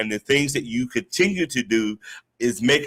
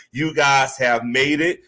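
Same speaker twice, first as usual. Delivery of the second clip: usual speaking voice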